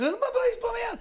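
mock damsel plea. On fire, asking a guy for help, high squeal. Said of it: "I was like".

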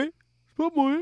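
mock whiny denial. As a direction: push the denial up into a whine. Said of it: "il dit".

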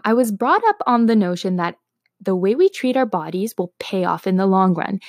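usual speaking voice

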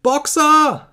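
very high, loud cry of horror; terrible scream. A narrator's reading. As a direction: scream the name in utter desperation. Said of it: "schrie Clover mit schrecklicher Stimme".

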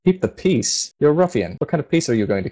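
mock the idea that this ruffian keeps peace; scornful disbelief. Paladin Avery scoffs at Gray Fox, high and scornful.

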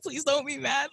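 high, small pleading voice; anxious plea. Retold or imitated. Quoting her anxious self to a friend, high pleading.

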